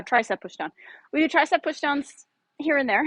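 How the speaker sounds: ordinary talk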